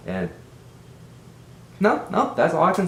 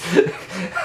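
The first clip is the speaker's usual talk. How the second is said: laugh; 6 semitones higher than usual. Real, not acted. Breaks up after his impression.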